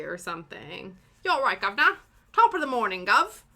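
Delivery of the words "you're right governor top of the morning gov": loud, higher mock British greeting. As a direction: belt out a cartoon British hello. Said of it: "British phrase, stereotype from T V".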